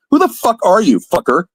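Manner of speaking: high, heated shout; angry demand. Acted. Demanding the stranger identify himself, furious. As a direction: throw the question at him furiously.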